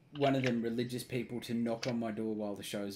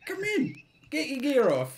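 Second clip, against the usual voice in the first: mock invite; 12 semitones higher, 5 dB louder than usual. Imitating inviting missionaries in.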